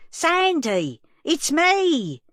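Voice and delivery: put-on old woman shouting through the glass door to reach Sandy. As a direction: call out over the din, rising each time. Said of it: "I shouted as best I could".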